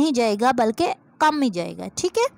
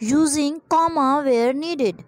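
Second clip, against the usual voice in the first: slow dictation; much slower than usual. Shown as a demonstration. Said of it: "reading exercise instruction".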